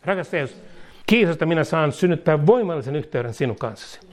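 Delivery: fervent prayer, each line said twice; led prayer. Acted. Preacher leading listeners line by line in fervent prayer. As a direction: pray aloud so others can repeat. Leads congregation in repeated prayer.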